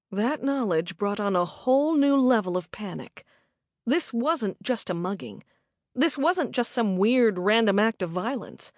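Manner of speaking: plain reading voice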